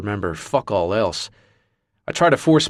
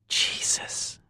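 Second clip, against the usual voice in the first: unvoiced, shocked whisper; 8 dB quieter than usual. Jayla seeing wrecked Marcus, whispering. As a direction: breathe it out in shock. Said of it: "Jayla whispers".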